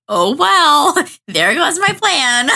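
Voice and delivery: high, drawn-out comic resignation; hammed-up letdown with a laugh; mock dismay. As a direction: play a schemer whose plan just collapsed. Acts Lloyd as his plan fails.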